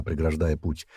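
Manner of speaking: plain reading voice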